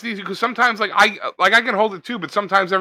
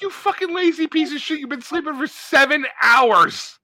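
high-pitched comic exasperation; hammed-up squeaky outrage; mock scolding; 8 semitones higher than usual. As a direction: berate a lazy pet, stretching the last words. Mock berating his lazy cat.

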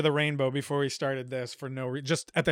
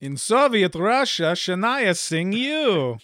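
put-on louder, higher, slow Russian-accented delivery; mock Russian accent; 5 semitones higher, 5 dB louder, much slower than usual. Host jokes for the group, mock Russian accent. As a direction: deliver the punchline as a hammy Russian. Russian comedian style joke.

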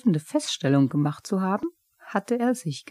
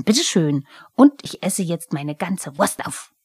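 louder, fast furious kobold rant; a stamping goblin ranting about the cat; angry Pumuckl; 9 dB louder than usual. Acted. Wütender Kobold schimpft.

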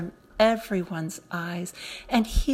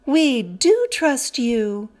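louder and slow, earnest cry; cried out; 4 semitones higher, 7 dB louder, slower than usual. A narrator's reading. The children promising Hope, louder slow earnest cry. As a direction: call it out together, slowly. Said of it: "cried Epimetheus and Pandora".

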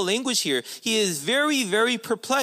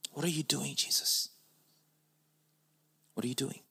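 hushed pleading; 4 semitones lower, 10 dB quieter, faster than usual. Acted. John pleading with Jesus, voiced.

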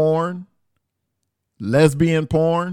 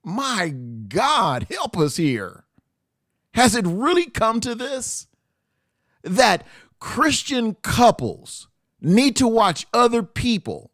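exasperated; 8 semitones higher than usual. Real, not acted. Exasperated plea to God.

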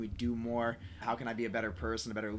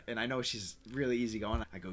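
ordinary delivery

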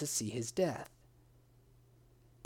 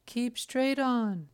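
high, slow, drawn-out ogress; 9 semitones higher, 4 dB louder, slower than usual. A narrator's reading. Ogress directing the lost prince, high and slow. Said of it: "said the ogress".

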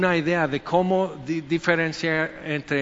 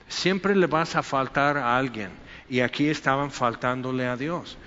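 normal talking voice

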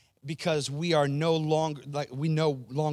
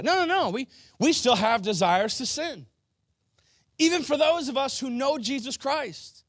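much higher, louder emphatic preaching; fiery preaching; 9 semitones higher, 6 dB louder than usual. Acted. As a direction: drive the point home to the crowd. Preaching at full force.

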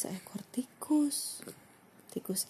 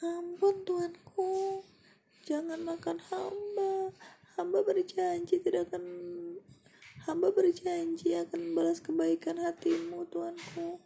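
pleading mouse; 9 semitones higher than usual. Acted. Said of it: "kata tikus".

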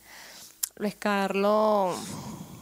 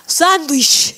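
mock kid voice; 6 semitones higher, 13 dB louder than usual. Mimicking how the kids say it.

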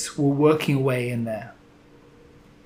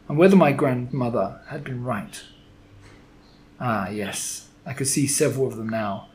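usual speaking voice

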